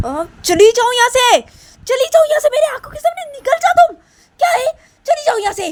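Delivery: very high, very loud screaming; shrieking. Acted. Nisha screaming at the girl to get out, shrill shriek. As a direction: scream her out of your sight.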